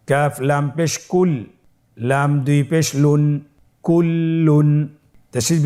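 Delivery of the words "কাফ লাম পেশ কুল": normal talking voice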